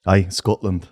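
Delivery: put-on low Scottish accent. Co-host moves on to next accent, Scottish accent. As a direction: answer like a Scotsman, low. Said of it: "And then Scottish".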